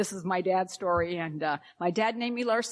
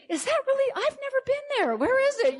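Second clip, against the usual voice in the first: much higher, wide-eyed mock-curious asking; mock curious strangers; 4 dB louder than usual. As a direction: play the puzzled stranger in a high, lilting voice. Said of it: "all the people that are saying to me".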